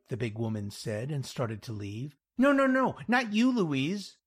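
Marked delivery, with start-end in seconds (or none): 2.4-4.2 loud, high, hurried backtracking; flustered denial. Acted. Jack stopping Louise as she starts to leave, flustered. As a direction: rush to take it back, high and loud. Stops offended client from leaving.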